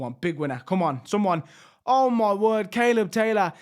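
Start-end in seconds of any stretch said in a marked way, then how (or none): 1.9-3.5 higher and louder, caught by surprise; stunned exclamation. Real, not acted. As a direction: gasp it out at the shock goal. Stunned by a goal.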